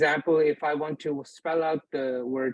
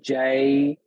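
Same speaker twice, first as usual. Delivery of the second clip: jayyid with the doubled ya held, "jay... yid"; stressed ya; 5 dB louder than usual. Shown as a demonstration. Teacher showing students the stressed vowel, jayyid drawn out and split. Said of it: "I stressed the vowel ya".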